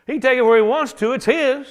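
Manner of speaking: loud high mocking shrug of a line; mock dismissive. Preacher mocking a lazy reading to the congregation, loud and scoffing. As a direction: toss it off as a silly excuse, loud. Preacher mocks excuse loudly.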